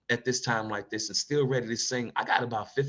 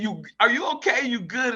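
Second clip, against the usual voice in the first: higher, louder, lightly voiced worried clerk; concerned clerk; 12 semitones higher, 5 dB louder than usual. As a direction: ask him if he's all right. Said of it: "the clerk just looking at me saying".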